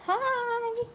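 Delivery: very high pet greeting; high squeaky hi. Real, not acted.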